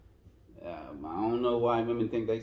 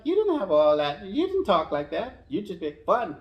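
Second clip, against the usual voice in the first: teasing; 9 semitones higher, 8 dB louder than usual. Retold or imitated. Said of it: "I'm just like".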